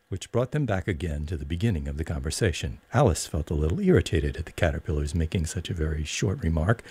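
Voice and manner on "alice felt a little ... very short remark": ordinary delivery, nothing marked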